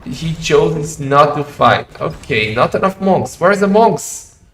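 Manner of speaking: excited live commentary, loud and high; hyped caster shouting. Real, not acted. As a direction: call the fight at full hype. Caster hypes the monk fight.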